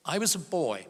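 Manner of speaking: ordinary delivery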